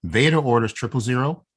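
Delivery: normal talking voice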